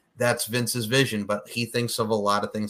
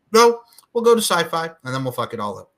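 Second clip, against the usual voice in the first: higher, louder mock refusal; mock dismissive boss; 7 semitones higher, 6 dB louder than usual. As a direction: overrule them as a caricatured boss. Mimics Vince overruling an idea.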